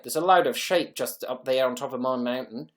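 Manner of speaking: put-on second go at a Kiwi accent; wonky mock New Zealand twang; attempted New Zealand accent. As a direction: have another stab at New Zealand vowels. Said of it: "That doesn't sound right".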